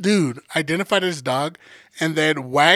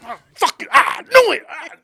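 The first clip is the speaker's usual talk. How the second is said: much higher and louder, hammed-up cry of "I knew it"; mock outraged yell; 10 semitones higher, 5 dB louder than usual. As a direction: yell it like you were proven right. Retold outburst, cry shout.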